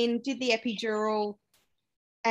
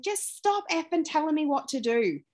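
retold outburst, pitched higher; mock snapping; 7 semitones higher than usual. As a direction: snap it out the way she did in labour. Swearing at midwife during labour.

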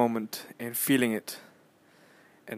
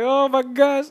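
thrilled; 10 dB louder, slower than usual. Retold or imitated. His own thrilled reaction.

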